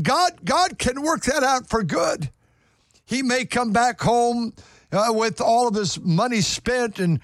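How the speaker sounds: high, rising exhortation; fervent preaching. Acted. Pastor urging the congregation to trust God, fervent preaching. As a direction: preach hope into their trials.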